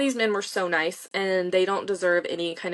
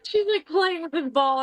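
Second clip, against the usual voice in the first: laughing; 9 semitones higher, 4 dB louder than usual. Real, not acted. Laughing about kid with ball.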